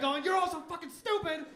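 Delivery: mock grumpy elder yelling; old man shouting at the young; angry old man. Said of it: "'Cause they're like".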